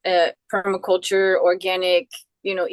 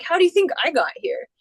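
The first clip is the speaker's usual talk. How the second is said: challenging billionaire; 8 semitones higher, faster than usual. Retold or imitated. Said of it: "he's like".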